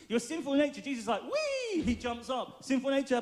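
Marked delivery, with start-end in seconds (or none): 1.2-1.8 very high mock whoop; cartoonish burst of glee; playful gleeful squeal. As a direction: leap up with a comic high cry. Voices Jesus jumping up from grave.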